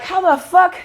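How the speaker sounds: high, heated disbelief, retold; a young self's mock fury at the elites; mock angry outburst. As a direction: fire it off like outraged at learning the truth. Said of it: "Then you got angry".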